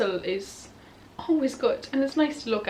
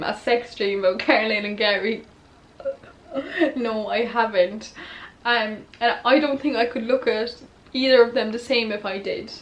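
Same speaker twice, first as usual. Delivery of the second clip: ordinary talk, nothing marked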